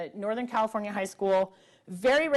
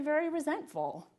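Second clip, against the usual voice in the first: usual speaking voice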